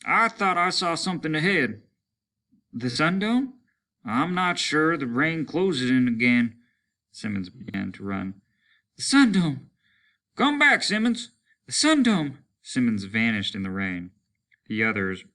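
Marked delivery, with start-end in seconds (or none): 0.0-6.6 higher, raised uncertain voice; uncertain lieutenant. Acted. Lieutenant tells his men, raised unsure voice. 9.0-12.4 much higher, loud shouts; urgent shouting. Acted. Soldiers shout as Simmons runs, loud high yells.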